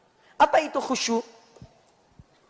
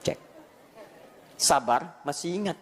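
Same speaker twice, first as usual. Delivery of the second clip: ordinary talk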